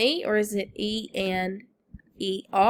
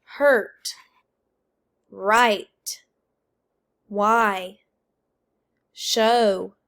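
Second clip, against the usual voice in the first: each word alone, loud and evenly spaced; word-list drill; 5 dB louder, much slower than usual. Shown as a demonstration. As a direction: call out words for the class to repeat. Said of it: "I'm gonna say the word".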